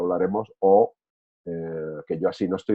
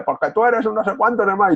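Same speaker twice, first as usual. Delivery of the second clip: much higher, mock angry yell; 12 semitones higher than usual. Other person insulting you in an argument, imitated mock yell. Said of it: "si el otro grita y dice".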